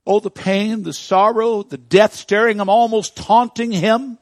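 loud, high preaching swell; fervent pulpit cry; preaching at full force. Acted. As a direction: build the pain to a roar. Preaching about Lazarus' tomb.

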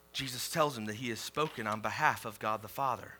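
usual speaking voice

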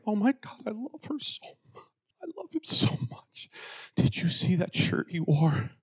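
mock a lovestruck, easily thrilled teen; gushing teenager. Preacher mimicking teens consumed with crushes, gushing. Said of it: "especially when you're a teenager".

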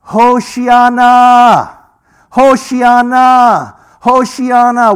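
high, drawn-out loud cry; crying out Hoshiana. Retold or imitated. Worshippers shaking the lulav at the Wall, crying out loud. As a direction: call it out long and ringing, over and over. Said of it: "what they're saying when they shake the lulav".

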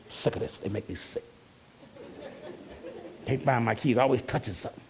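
low, muttered complaining; fussing to yourself on the way to work; grumbling under breath. Retold or imitated. Preacher mimicking a believer muttering about lost keys. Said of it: "you fussing all the way to work".

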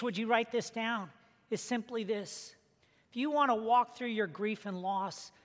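usual speaking voice

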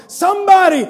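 high, loud shouted pleas; terrified woman shouting for someone to call the police; screaming for help. Acted. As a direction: scream for help at the top of your lungs. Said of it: "begins to scream at the top of her lungs".

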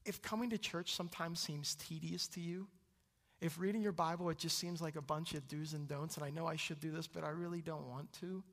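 ordinary talk

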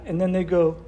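ordinary talk

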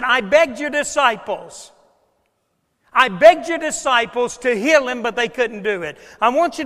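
preacher acting a father's impassioned appeal; desperate appeal. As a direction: plead forcefully as the let-down father. Father's desperate complaint to Jesus.

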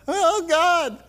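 loud, high wail of comic dread; mock alarm. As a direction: cry out in hammed-up dismay. Retold dread at bad news.

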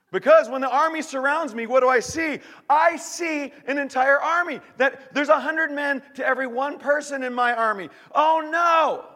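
high, loud mock alarm; acted panic. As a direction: shout it like you see the army. Acts being surrounded by army.

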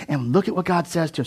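normal talking voice

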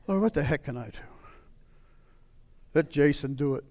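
tired prayer handing it off; defeated mutter. Retold or imitated. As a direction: give up under your breath. Worn-out resigned prayer.

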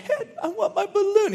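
put-on high, whiny little girl. Little princess begging her dad for the balloon, high. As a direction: play the small girl pleading. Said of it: "she's saying".

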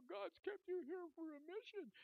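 very high, tearful retelling of a nurse; high mock-weepy voice; imitated crying. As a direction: say it as if crying, pitched right up. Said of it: "she's crying now".

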